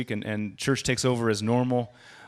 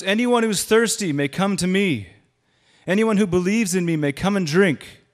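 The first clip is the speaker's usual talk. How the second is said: raised, louder voice calling out; Jesus shouting; 9 semitones higher, 6 dB louder than usual. Retold or imitated. Preacher voicing Jesus calling to the festival crowd, loud and raised. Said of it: "Jesus stood and shouted to the crowds".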